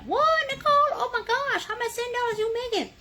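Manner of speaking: high, excited exclamation; mock amazed viewer. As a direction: squeal it like a thrilled fan. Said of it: "You're gonna be like".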